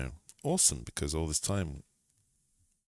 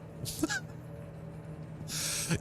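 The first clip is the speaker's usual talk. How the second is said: high-pitched laugh; 9 dB quieter than usual. Real, not acted. Laughs at dead body.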